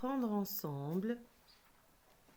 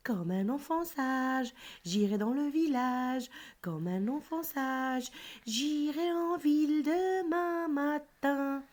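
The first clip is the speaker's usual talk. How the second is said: put-on singsong teacher's recital of a children's verse; lifted, rhythmic poem-reading voice; poem recitation; 4 semitones higher, 5 dB louder than usual. Shown as a demonstration. Teacher recites whole poem for pupil.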